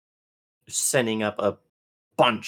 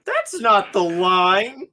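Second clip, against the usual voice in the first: high voice; 11 semitones higher, 5 dB louder, slower than usual. Pretend. Mock correction in high voice.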